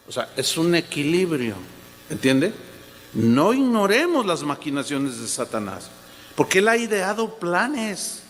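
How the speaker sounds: normal talking voice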